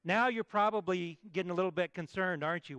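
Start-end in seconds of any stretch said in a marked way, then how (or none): none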